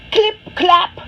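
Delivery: loud, high clip-clap like hooves; clip-clap hoof sounds. A narrator's reading. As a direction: call out the hoofbeats brightly. Donkey hooves sound effect.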